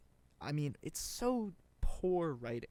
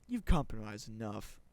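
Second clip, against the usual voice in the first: lightly imitated ad voice, louder; ad announcer impression; 3 semitones lower, 6 dB louder than usual. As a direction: mimic the ad guy with some punch. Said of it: "The guy's voice is funny".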